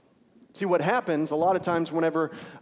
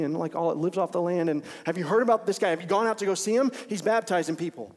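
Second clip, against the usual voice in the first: eager gossip, a bit higher and faster; excited crowd chatter; 4 semitones higher, 4 dB louder than usual. Retold or imitated. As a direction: hype him up like the buzzing crowd. Crowds telling John he's big.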